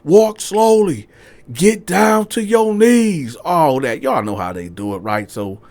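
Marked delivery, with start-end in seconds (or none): 0.0-3.4 high, loud, slow commands; police commands. Retold or imitated. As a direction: spell out the orders. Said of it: "they shooting the commands out".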